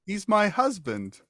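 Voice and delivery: high-pitched wife character; townswoman. Acted. Wife tells visitor about the bartender, high voice.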